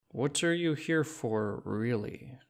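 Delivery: put-on a suspicious shopkeeper in a Czech accent; dense Czech accent. Shopkeeper sizing up a stranger, slow Czech accent. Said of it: "The shopkeeper narrowed her eyes".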